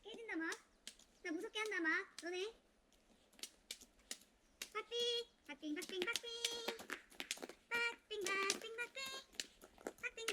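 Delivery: high, soft, trembly little squeak; a tiny creature frightened on the ice; scared tiny high voice. Acted.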